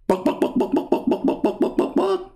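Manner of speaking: whispered mock clucks ending in a high squawk; breathy clucking that pops up high at the end; chicken clucking.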